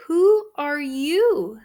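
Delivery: slow, loud, high astonishment; a princess astonished by the boy; amazed princess. Acted. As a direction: gasp it out in wonder.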